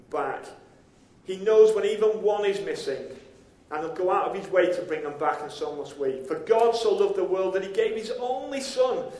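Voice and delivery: ordinary delivery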